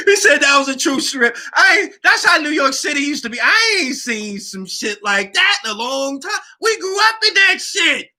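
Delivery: hyped up reminiscing; excited. Real, not acted. Recalling the strip to viewers, excited shouting.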